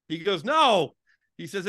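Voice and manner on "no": high, loud retold no; emphatic correction. Man correcting the Fozzie Bear guess, emphatic. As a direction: shoot down the wrong guess, imitating him.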